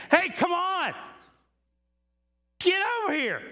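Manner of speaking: loud, high-pitched holler; calling out from ahead. Acted. God out in front calling you onward, high impatient shout. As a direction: yell for someone to catch up. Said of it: "he's out in front of you saying".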